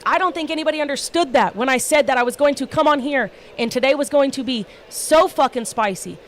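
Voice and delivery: usual speaking voice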